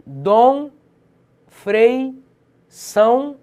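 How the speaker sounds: each example word called out loud and high; Dom, Frei, São punched out one by one. Shown as a demonstration. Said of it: "existem outros monossílabos átonos".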